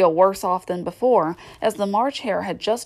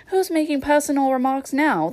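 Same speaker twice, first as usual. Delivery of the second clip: high-pitched, mildly triumphant challenge; 7 semitones higher than usual. A narrator's reading. Hatter catching Alice out, lightly triumphant. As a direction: score a point, voice pitched up. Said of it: "asked triumphantly".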